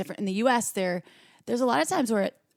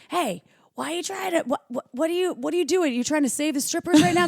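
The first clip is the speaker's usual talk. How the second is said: high, teasing friend imitation; mock the eager rescuer, pitched up; teasing partner voice; 8 semitones higher, 4 dB louder than usual. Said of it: "my partner ... is always like".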